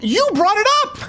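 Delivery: high, exasperated comeback with a laugh; incredulous. Retold or imitated. Speaker answering Chicago guy's GDP complaint, incredulous.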